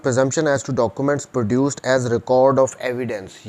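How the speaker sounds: slow, measured dictation of the law text; reading statute aloud. Shown as a demonstration. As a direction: read each clause slowly for notes. Lecturer reads statute text aloud. (क़ानून की धारा धीरे-धीरे पढ़कर सुनाना)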